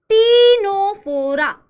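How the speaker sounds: "टीनोफोरा" loud, each syllable held long; drawn-out "टीनोफोरा", starting on t; slow model pronunciation. Shown as a demonstration. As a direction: call out "टीनोफोरा" very slowly for the class. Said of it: "क्या बोलते हैं बेटा".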